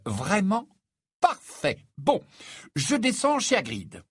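high, breezy self-assurance; elated confidence. A narrator's reading. Harry announces he is off to Hagrid's, elated. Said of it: "Débordant de confiance en lui-même".

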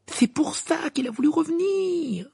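very high, slow, strained exclamation; angry outburst. A narrator's reading. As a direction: burst out as if thumping the table. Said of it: "en tapant du poing sur la table". (emporté, voix haute)

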